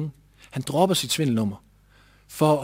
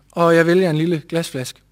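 ordinary delivery

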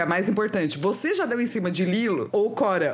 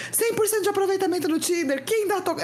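mock yell; 11 semitones higher than usual. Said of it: "berrando assim".

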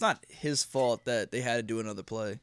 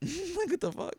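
laughing disbelief; slower than usual. Real, not acted.

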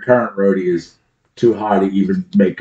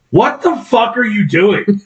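angry yell; 9 semitones higher, slower than usual. Retold or imitated. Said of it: "I've heard you be like".

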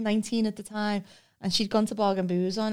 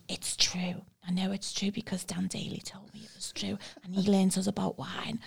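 softer, half-whispered defence; whispered insistence; 6 dB quieter than usual. Retold or imitated. Defending Dan's wine fact to her mate, whispered insistence. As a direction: insist under your breath. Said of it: "I went".

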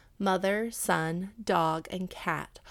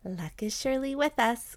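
higher-pitched, gleeful wife's voice; a touch of excitement; delighted cry; 5 semitones higher than usual. A narrator's reading. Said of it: "cried Missus Chu, clapping her hands".

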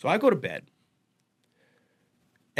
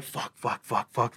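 panicked whisper; 5 semitones lower, 7 dB quieter, slower than usual. Retold or imitated. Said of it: "I'm like".

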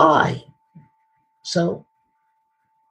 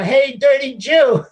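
higher, drawn-out mock yell; imitated yell; 6 semitones higher, slower than usual. Man heckling a passer-by from his car, imitated yell. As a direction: call it out slowly like a heckler. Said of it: "this guy yells out of his car".

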